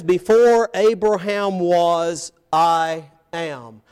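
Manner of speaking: loud, slow proclamation; emphatic proclamation. Acted. Jesus daring to equate himself with God, emphatic. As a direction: drive each word out, holding "I am". Climactic quote of Jesus's claim.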